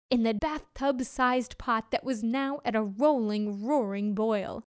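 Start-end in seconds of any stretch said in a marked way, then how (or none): none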